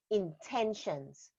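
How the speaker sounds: intentions slow and drawn out, dropped soft; stress on intentions. Shown as a demonstration. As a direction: lean on intentions, stretch it out slowly. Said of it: "place special emphasis on the word".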